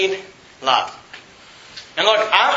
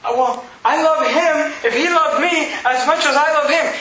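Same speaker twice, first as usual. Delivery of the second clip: higher, laughing mock wife's voice; 6 semitones higher than usual. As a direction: lightly mimic a sulking wife with a laugh. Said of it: "Women get this idea".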